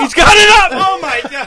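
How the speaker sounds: high, loud squeal of disbelief; shrieking disbelief. Real, not acted. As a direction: shriek it in amazement. Friends mocking Dom's quiz.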